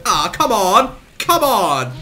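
loud angry shout; frustrated yell. Real, not acted. Gamer angrily yelling at the game, loud. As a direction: yell it at the screen.

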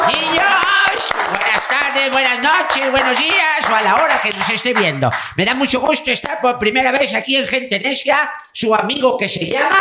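bright high clown host voice. Acted. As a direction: greet the kids like a clown. Clown host greeting kids.

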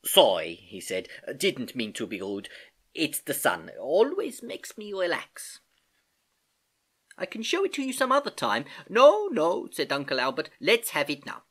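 put-on easygoing older relative, a little animated; lightly put-on voice of an amiable uncle; lightly voiced apologetic uncle. Uncle excusing himself to his niece, amiable.

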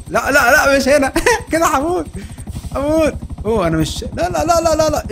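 a gamer's alarm at crashing; high frantic no no while crashing; panicked no. Real, not acted. About to crash the plane.

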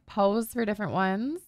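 ordinary delivery

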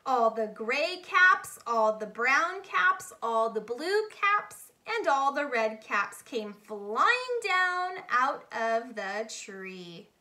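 plain reading voice

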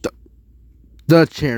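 usual speaking voice

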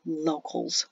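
low, soft, derisive remark. A narrator's reading. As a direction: drop it quietly with disdain. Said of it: "someone said derisively".

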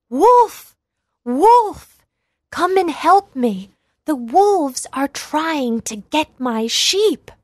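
high, loud shout for help; crying out. A narrator's reading. Boy crying out to the villagers, loud shout. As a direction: yell it with all his might. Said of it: "crying out with all his might".

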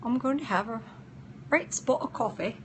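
put-on British accent. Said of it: "enjoy my British accent".

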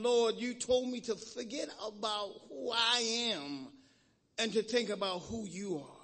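low, hushed prayer voice; closing prayer. Acted. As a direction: pray it low and unhurried, almost to himself. Preacher leads closing prayer.

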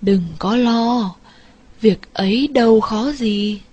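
slow, gentle fairy elder; a light touch of a smiling old Buddha; kindly reassuring. A narrator's reading. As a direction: soothe the boy like a benevolent spirit. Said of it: "Bụt cười và bảo rằng".

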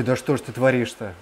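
ordinary talk

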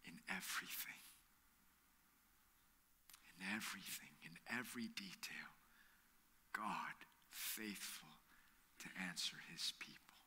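preacher whispering the closing point; hushed preaching. Real, not acted. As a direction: lean in and whisper the profound truth. Hushed sermon climax.

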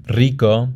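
rico with a rolled first R; trilled initial R. Shown as a demonstration. Teacher shows the familiar Spanish R, trilled. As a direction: roll the R that starts rico. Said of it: "trilled R in the beginning".

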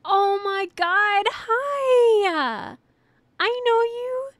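high, loud delighted squeal of recognition; excited greeting. Real, not acted. Recognizing a returning viewer.

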